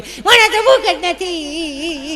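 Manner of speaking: very high, long drawn-out whine; whining child. Acted. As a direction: stretch it into a child's wail. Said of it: "ચોથું તેમ જ કે". (બાળકનો લાંબો તીણો કકળાટ)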